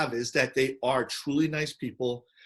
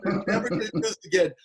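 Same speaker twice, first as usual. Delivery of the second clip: fast, hammed-up rant; mock rant; 4 semitones higher, faster than usual. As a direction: rattle off the grievance. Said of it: "this rant".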